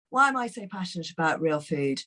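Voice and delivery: ordinary delivery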